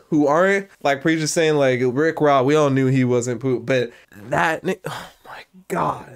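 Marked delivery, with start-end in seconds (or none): 4.9-6.1 breathy awed whisper; hushed oh my God. Real, not acted. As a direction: whisper it in disbelief.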